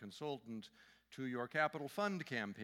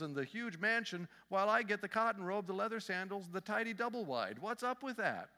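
high, indignant outrage, louder in the middle; outraged minister; 8 semitones higher, 3 dB louder than usual. Acted. A minister protests his reward to Saint Peter, outraged and high. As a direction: protest loudly like a wronged preacher. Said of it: "the minister is outraged".

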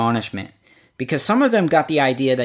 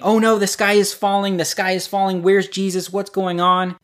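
higher, imitating frantic worry; mock panic; 6 semitones higher than usual. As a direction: play the panicking sheep. Panicking flock, voiced.

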